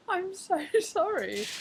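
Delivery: high, slow, laughing sorry; mortified laughing sorry; laughing apology. Retold or imitated. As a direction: giggle through the apology.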